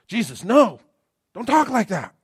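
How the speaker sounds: high, loud pleading protest; alarmed Peter. Acted. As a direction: beg him to stop talking that way.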